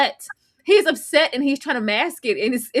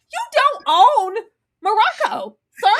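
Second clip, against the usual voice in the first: mock retort; 7 semitones higher, 4 dB louder than usual. Host answering his claim to the country, mock indignant.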